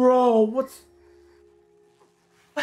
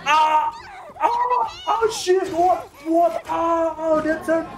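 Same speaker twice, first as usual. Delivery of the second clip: high, startled cries of disbelief; real shocked gamer outburst; 3 semitones higher than usual. Real, not acted. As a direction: react in sudden alarm, pitch jumping up. Startled reaction during gameplay.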